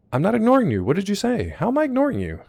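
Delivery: indignant, high and loud; squeaky indignant denial to chat; high protest. Real, not acted. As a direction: protest your innocence up high.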